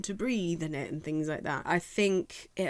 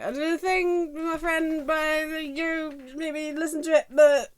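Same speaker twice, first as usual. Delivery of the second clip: shy mock plug; 9 semitones higher, 5 dB louder than usual. Imitating her shy song plug.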